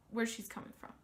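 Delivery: ordinary delivery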